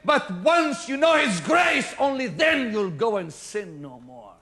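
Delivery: loud, slow, soaring preacher's cry; full-force preaching. Acted. Preacher thunders at the congregation, full force. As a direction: hammer the line home to the church.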